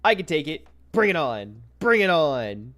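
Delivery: loud, goading challenge; mock defiant shouting. Streamer acting out chat daring Ryan, loud mock bravado. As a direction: shout it like daring someone to charge. Acts out chat's taunt to Ryan.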